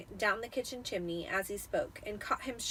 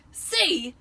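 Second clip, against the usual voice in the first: loud, higher gruff uncle; Uncle Vernon voice; 7 semitones higher, 10 dB louder than usual. A narrator's reading. Vernon explaining to Petunia, mouth full of nails, blustering. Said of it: "he explained to Aunt Petunia".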